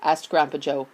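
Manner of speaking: plain reading voice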